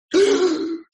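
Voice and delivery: loud, high gasp; acted gasp of dismay; shocked gasp. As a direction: suck in air in alarm. Reacting to dean's surprise assignment.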